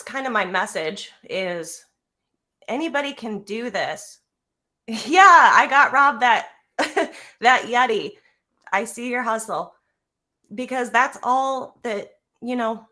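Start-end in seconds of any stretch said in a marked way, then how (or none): 4.9-8.1 laughing loudly through the words; a real laugh spilling over the line; laughing while telling Rob about the Yeti. Real, not acted. Laughs at the gift joke.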